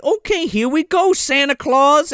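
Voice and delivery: high mock-cheerful send-off; imitated fairy. Fairy sending Santa to Africa, high mock send-off. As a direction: sing out a hammed-up departure call, pitched high. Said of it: "And she's like".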